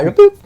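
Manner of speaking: squeaky high boop; playful boop. Acted. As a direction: pop it out cartoonishly. Playful sound effect.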